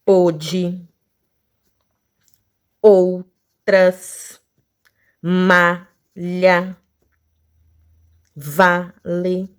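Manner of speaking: each word slowly split into two beats; level, separated syllables, word after word. Shown as a demonstration. Teacher listing two-syllable words, each slowly broken in two. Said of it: "a palavra dissílaba".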